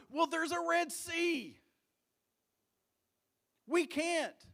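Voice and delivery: high, loud helpless protest; acted panicky outcry; alarmed objection. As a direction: push back in mock desperation. Acted panicked objection to God's order.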